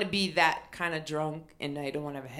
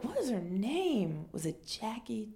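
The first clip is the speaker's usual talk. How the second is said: high, soft, drawn-out wondering; puzzled musing; 5 semitones higher, 5 dB quieter than usual. Retold or imitated. Storyteller racking her memory for the woman's name, puzzled musing. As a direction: muse to yourself, searching for it.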